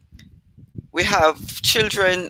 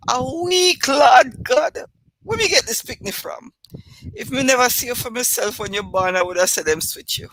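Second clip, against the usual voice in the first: Jamaican patois scream; 8 semitones higher than usual. Retold or imitated. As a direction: shriek it like mama in patois. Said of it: "unladylike screams of".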